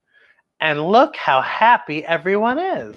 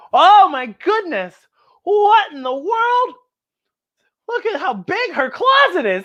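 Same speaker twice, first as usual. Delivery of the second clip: amazed gasp; 11 semitones higher than usual. A narrator's reading. Reading to kids, astonished by the closet picture. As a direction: wonder at what they see.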